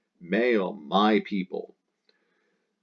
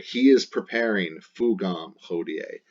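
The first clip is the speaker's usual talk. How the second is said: normal talking voice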